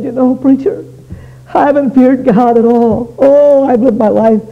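high, loud bawling confession of a broken-up lad; tearful confession. Retold or imitated. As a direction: act out a teenager bawling to his preacher. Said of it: "just literally bawled and bawled and bawled".

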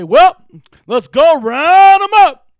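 loud, high, slowed mock roundup call; hammed-up loud call to round them up; mock eager shout. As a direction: ham up a pushy recruiter. Rejected version of Philip's reply.

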